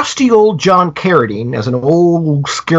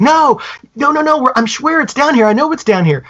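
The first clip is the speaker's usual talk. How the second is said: put-on desperate kid; 11 semitones higher than usual. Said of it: "his buddy's like".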